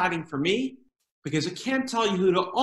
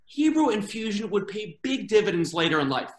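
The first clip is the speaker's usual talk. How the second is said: ordinary delivery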